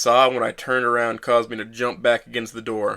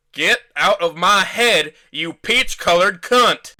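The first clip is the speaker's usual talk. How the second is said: slow, much higher, loud enraged yell; vitriol and rage; 8 semitones higher, 6 dB louder, much slower than usual. A narrator's reading. Man raging at the ghost in his head, slow furious yell. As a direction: spit every word with hatred. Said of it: "my voice dripping with vitriol and rage".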